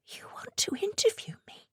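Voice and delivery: high breathless whisper of disbelief. Acted. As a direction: whisper it in stunned wonder. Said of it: "she whispered".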